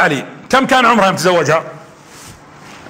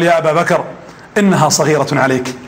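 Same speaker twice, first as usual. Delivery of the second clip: much lower, reluctant refusal; embarrassed; 8 semitones lower than usual. Retold or imitated. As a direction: retell an awkward, apologetic letdown to a friend. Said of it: "منحرج النبي صلى الله عليه وسلم".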